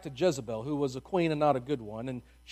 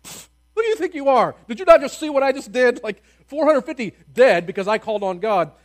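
loud, higher scoffing brag; cocky scoff; 7 semitones higher, 10 dB louder than usual. Retold or imitated. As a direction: scoff at her, big and boastful. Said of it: "I'd be like".

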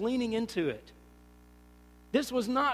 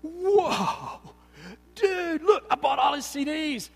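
high, loud, laughing rave; mock superfan raving after the concert; gushing convert; 5 semitones higher, 6 dB louder, slower than usual. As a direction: gush like a new superfan. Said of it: "I went".

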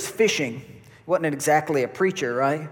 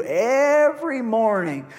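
higher, louder, drawn-out proclamation; preaching emphasis; 7 semitones higher, 4 dB louder, slower than usual. Real, not acted. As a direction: ring out every morning slowly. Preaching climax on mercy.

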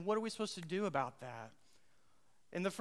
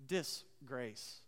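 Disgrace drawn out slowly and quietly; slow, soft disgrace; 7 semitones lower, 8 dB quieter than usual. Shown as a demonstration. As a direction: let the word sink in. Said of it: "sounds different when you say it slower".

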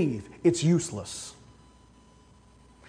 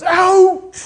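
loud high umpire shout; umpire's call; 11 semitones higher, 6 dB louder than usual. Retold or imitated. Umpire calling the runner out, loud shout.

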